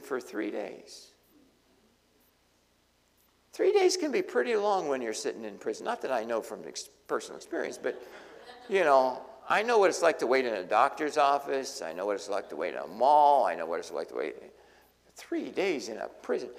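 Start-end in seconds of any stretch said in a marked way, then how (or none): none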